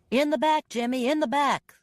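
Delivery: shout. A narrator's reading. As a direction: call to a fellow rescuer over the fire. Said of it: "someone shouted".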